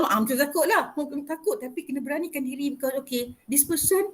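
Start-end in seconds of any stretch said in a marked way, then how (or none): none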